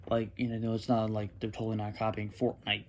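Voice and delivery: low, quiet sarcastic mutter; sarcastic aside. Real, not acted. Gamer slyly accusing the developers of copying, sarcastic aside. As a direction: toss it off dryly under your breath.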